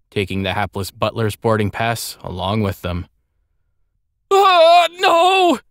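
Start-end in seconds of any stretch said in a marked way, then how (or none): 4.3-5.6 very high, loud wail; grief-stricken cry. A narrator's reading. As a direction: cry out in utter despair. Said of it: "The boy's grief-stricken cry resonated".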